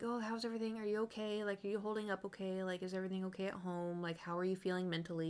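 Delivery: soft, gentle check-in; imitated concerned teacher. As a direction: imitate a teacher quietly asking after a pupil. Teachers checking on her after class.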